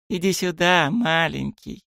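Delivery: put-on high sweet baby talk; cartoon princess cooing. A narrator's reading. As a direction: coax the little bunny, high. Cooing to the bunny. (сюсюканье с зайчиком)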